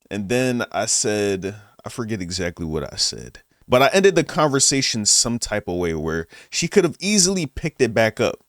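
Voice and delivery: ordinary delivery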